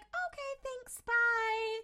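high-pitched sing-out farewell, imitated; mock polite goodbye. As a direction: chirp a put-on polite brush-off. Acted hasty polite goodbye.

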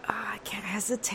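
hushed whisper to herself; breathy inner whisper; whispered thought. Retold or imitated. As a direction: whisper it like a private panic. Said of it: "I was like".

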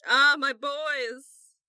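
loud, drawn-out gushing hello; effusive greeting. Acted. Lavender greeting Ben and Robbie, effusive. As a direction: greet them loudly, stretching it grandly.

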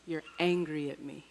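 soft, still and low-key; very quiet voice. Retold or imitated. God speaking into her spirit, very quietly. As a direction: say it gently, almost under the breath. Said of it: "this very quiet voice said to me".

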